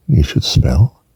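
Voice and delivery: ordinary talk, nothing marked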